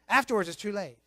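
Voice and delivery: ordinary talk, nothing marked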